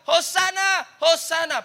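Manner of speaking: shouted high and loud Hosannas; cheering crowd. Retold or imitated. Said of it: "People are crying out".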